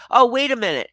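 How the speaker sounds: ordinary talk, nothing marked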